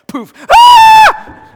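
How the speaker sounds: very high, loud mock shriek; startled scream. Disciples as Jesus suddenly appears, startled scream. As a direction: shriek as if something popped out of nowhere.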